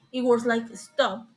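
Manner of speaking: usual speaking voice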